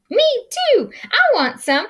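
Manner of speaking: very high eager voices; high excited critter; eager squeak. Acted. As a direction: squeal for some. Eager prairie dogs.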